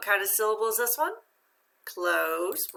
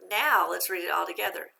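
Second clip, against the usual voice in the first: ordinary delivery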